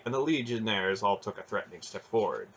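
usual speaking voice

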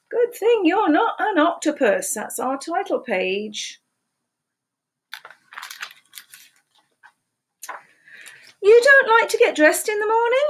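lively picture-book read-aloud, questions pitched high; animated storytime voice with playful questions; reading to children. Acted. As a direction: read it like storytime for little ones.

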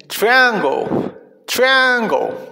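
"triangle" said clearly, falling at the end; repeat-after-me model. Shown as a demonstration. Teacher leading children in practice, clear model "triangle". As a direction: model "triangle" for learners to echo. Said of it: "Repitan después de mí".

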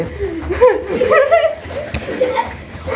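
shrill burst of laughter; high squealing laugh. Real, not acted. Watching the dog run in circles, he squeals laughing.

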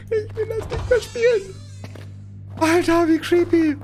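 high, strained, spooked; whimpering in fear; scared horror game streamer. Real, not acted.

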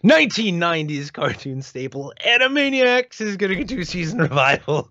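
high, showy news-announcer delivery; hammed-up headline read. Reads news item in announcer style.

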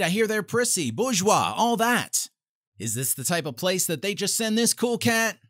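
ordinary talk, nothing marked

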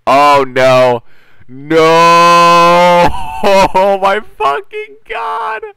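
rising panic into a high, loud scream; panicked scream. Real, not acted. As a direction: shriek in real panic as he closes in. Enemy rushes in, panic.

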